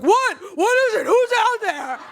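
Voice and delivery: hammed-up man voice, still high; fake man voice. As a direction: try to sound like a man but stay shrill. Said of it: "pretending I'm a man".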